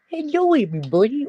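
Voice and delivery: high, quick pet voice; high quick voice speaking for the puppy; puppy voice greeting. Pretend. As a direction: greet everyone as the puppy would.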